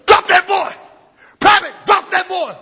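high, loud command; colonel's order. Retold or imitated. Colonel yelling at the private to drop the boy.

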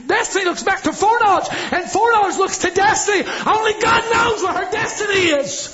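high, ringing preacher's cry; full-force preaching. Acted.

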